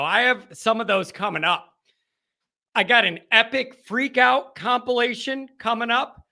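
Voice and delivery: normal talking voice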